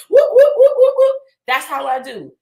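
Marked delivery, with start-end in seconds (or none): 0.1-1.2 high, loud party whoops; whooping. Acted. Cheering a small win, loud whoops.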